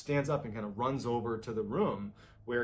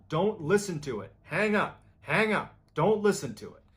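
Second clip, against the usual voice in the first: raised, higher-pitched repeated warning; retold yelling; 6 semitones higher, 5 dB louder than usual. Recording plays back Bill warning his friend, retold yelling. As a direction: imitate yelling to hang up, each order punched. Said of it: "Bill was yelling to Michael".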